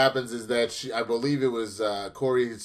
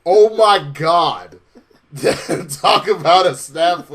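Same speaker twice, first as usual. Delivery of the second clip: drawn out, laughing and louder; slow laughing disbelief; 2 semitones higher, 7 dB louder, slower than usual. Real, not acted. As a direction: savor it slowly through laughter.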